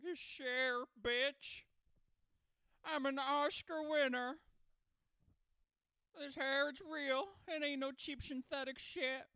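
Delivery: very loud, high diva boast. Acted. Star boasting of Oscar and hair, belted impersonation. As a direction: proclaim it as a hammed-up diva. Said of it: "a [name] comes out".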